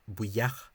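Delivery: "buyach" as boo-yakh, both syllables sounded; two-syllable pronunciation. Shown as a demonstration. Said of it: "suggests that it's only one syllable".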